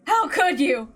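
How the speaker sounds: tearful reproach. Acted. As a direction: choke out the blame. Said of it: "obscured by anger and tears".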